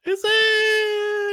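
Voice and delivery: very high, drawn-out anxious squeal; hammed-up squeaky worry; panicky doubt. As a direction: stretch it into a thin, high wail. Jittery character doubts being told it's okay.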